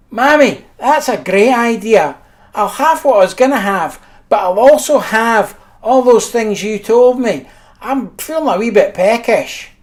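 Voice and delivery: put-on greedy boy agreeing to everything; a kid cheerfully ordering all of it; cheeky kid. Brother accepting everything.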